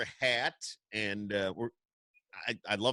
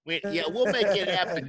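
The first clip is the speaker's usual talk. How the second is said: a higher, louder mob-boss promise said playfully; half-joking wise guy sealing the deal; mock mobster; 6 semitones higher, 4 dB louder than usual. Radio host answering the singer's Gambino joke as a playful mob boss. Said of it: "start calling you Gambino".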